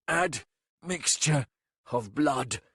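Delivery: rough hiss. A narrator's reading. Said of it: "in a rough hiss".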